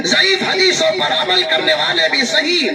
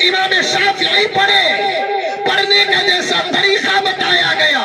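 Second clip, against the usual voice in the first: raised sermon voice; preaching; 3 semitones higher than usual. Acted. Preacher to the congregation, preaching with raised fervour.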